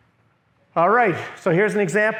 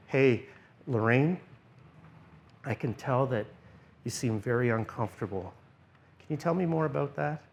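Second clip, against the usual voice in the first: soft, lower, calming question to someone uneasy; 5 semitones lower, 9 dB quieter than usual. Shown as a demonstration. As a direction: speak quietly so she feels safe. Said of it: "Lower your voice, quiet, turn down the volume".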